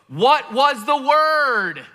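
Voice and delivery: high, slow, drawn-out puzzled ask; exasperated question. Retold or imitated. Charades crowd asks the word, exasperated and drawn out. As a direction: players pleading for the answer, drawn out.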